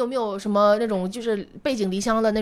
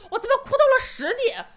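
笑着，不敢相信地上扬; 边笑边自问，语调抬高; laughing in disbelief; 11 semitones higher than usual. Real, not acted. Laughing at her own crying story. (笑着难以置信地问)

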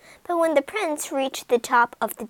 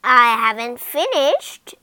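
louder threatening witch; loud cry; 9 dB louder than usual. Acted. Witch threatening Rapunzel after cutting her hair, loud. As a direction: threaten with more to come. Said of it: "cried the witch".

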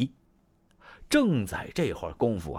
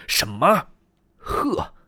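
loud, sharp, much higher exclamation; shocked outburst; 10 semitones higher, 4 dB louder, much slower than usual. Acted. Dong Zhuo learns Lü Bu is with Diao Chan, shocked. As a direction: bark out the shock.

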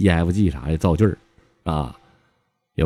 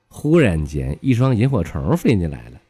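ordinary delivery

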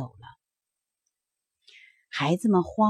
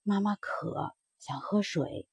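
faint, much softer plea from a sick mother; barely voiced, tired mother asking for water; a mother too weak to raise her voice; 11 dB quieter, slower than usual.

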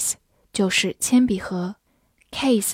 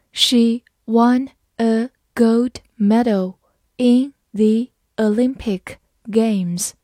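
very slow, word by word; slow reading; slower than usual. Shown as a demonstration. Teacher modelling for students, very slow and clear. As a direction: read it slowly for the class to copy. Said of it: "好，慢慢来读。".

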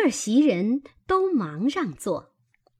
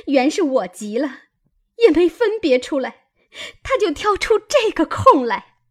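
raised, agitated voice climbing higher and louder; indignant explaining; 6 semitones higher, 3 dB louder than usual. A narrator's reading. Maid telling her two friends how she was baited, indignant and agitated. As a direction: explain it hotly, still worked up from the row. Maid still fuming after the quarrel.

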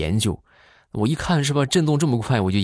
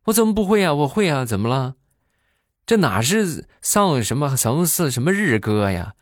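high, indignant protest; high-pitched mock-indignant insistence; indignant uncle; 6 semitones higher than usual. Uncle protesting to his nephew, high and indignant.

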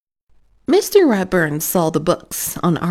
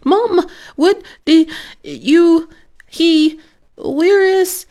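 much higher and louder, broken-off starts, slow; panicked stammer; 13 semitones higher, 6 dB louder than usual. Acted. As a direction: sputter in disbelief. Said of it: "Arthur stammered".

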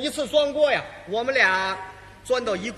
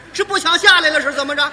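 put-on scolding old lady; 3 semitones higher, 9 dB louder than usual.

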